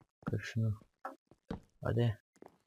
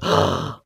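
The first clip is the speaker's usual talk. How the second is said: loud gasp; 3 semitones lower, 10 dB louder than usual. Real, not acted. Jump scare at four am.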